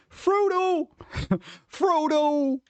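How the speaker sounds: high, far-off cry of the name; high Frodo call. Retold or imitated. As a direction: call out like Sam. Shouting the hobbit's name.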